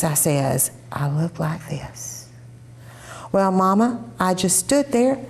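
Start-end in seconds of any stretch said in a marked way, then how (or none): 0.9-2.3 whispered deadpan mock look; mock child whisper; imitating the child. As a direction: breathe it out like a blank-faced child. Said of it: "This is the way she looks".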